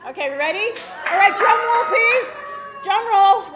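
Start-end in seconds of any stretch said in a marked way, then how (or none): 1.1-3.6 high, loud showman's shout; rally the room for the big moment; calling for suspense. Real, not acted. Calls for drum roll on stage.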